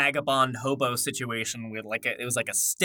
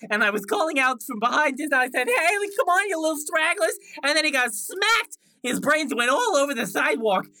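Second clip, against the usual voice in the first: high, earnest character voice; Lil Stragglers telling backstory; 11 semitones higher, 4 dB louder than usual. Acted. Orphaned sibling explaining the name, high and earnest. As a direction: tell it eagerly, pitched high.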